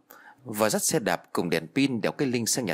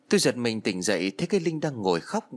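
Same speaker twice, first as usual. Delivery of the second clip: plain reading voice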